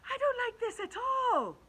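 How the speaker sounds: high, loud, fretful ladybug voice; a squeaky little bug in a panic; nervous ladybug. Acted. Ladybug dreading the cloud men, high fretful squeak. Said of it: "the ladybug said".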